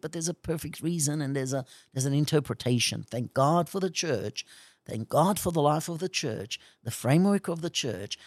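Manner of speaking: ordinary delivery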